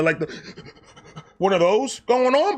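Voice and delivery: ordinary delivery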